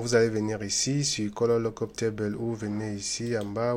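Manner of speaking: ordinary talk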